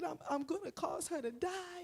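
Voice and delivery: high, tearful put-on wail; imitated crying. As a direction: act out a boy crying in distress. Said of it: "he's on the ground crying".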